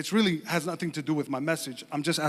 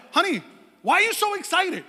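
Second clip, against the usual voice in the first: puzzled husband, aloud; 12 semitones higher, 4 dB louder than usual. Retold or imitated. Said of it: "I said that out loud".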